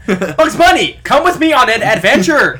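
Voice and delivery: loud, high, cheesy adventure line; bad acting. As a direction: overact like a bad actor. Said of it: "Am I doing it right?".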